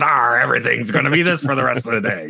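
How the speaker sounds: hammed-up pirate voice; pirate accent. Whale camp worker stuck talking like a pirate all day, pirate accent. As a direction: slip into a pirate accent, opening on a big "arr". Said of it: "stuck in a pirate accent".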